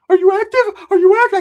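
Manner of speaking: very high, loud comic squeal; imagined cousin voice. Retold or imitated. Cousin with the girl as imagined, high loud squeal. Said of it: "I can imagine him".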